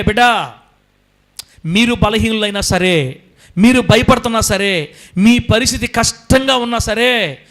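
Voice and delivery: loud, slow, rising divine promise; God reassuring his children, loud and slow. Acted. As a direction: speak as God comforting his children, building. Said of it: "అని చెప్తున్నాడు దేవుడు మనతో".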